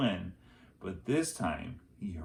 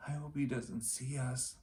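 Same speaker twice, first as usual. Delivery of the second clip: soft hushed worry, pitched up; hushed, high girl's voice; 4 semitones higher, 7 dB quieter than usual. A narrator's reading. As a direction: keep it down, scared of the tiger. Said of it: "whispered Annie".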